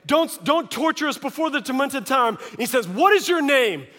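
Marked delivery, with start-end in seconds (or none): 0.0-2.4 higher, mock-desperate plea; pleading demons. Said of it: "this argument between he and the demons". 2.9-3.9 loud, high-pitched challenge; commanding demand. Acted. Jesus confronts arguing demons.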